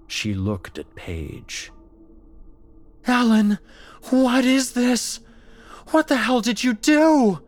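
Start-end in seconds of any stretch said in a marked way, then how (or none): 3.1-7.4 much higher and louder, horrified; frightened demand. Acted. Paige horrified, shrieking at her possessed friend.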